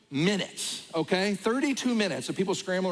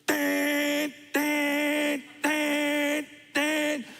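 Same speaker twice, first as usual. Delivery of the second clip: high, ringing ding ding of a ship alarm; imitated alarm chime; 9 semitones higher, much slower than usual. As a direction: ring it out like an alarm bell. Alarm waking them at night.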